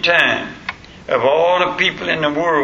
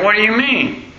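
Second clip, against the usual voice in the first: retold puzzlement, pitched high; puzzled question; 7 semitones higher than usual. New convert questioning the preacher, puzzled and high-pitched. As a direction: imitate a baffled friend, voice going up. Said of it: "he didn't understand".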